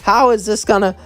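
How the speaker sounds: a light touch of flustered shock; flustered surprise. Acted. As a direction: caught off guard by a sudden kiss. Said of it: "she would blush and say".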